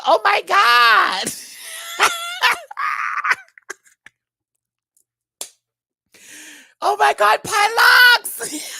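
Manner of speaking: high, loud, laughing shout. Retold or imitated. Quoting the queen while laughing hard, high and loud. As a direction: scream it while cracking up. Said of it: "She said".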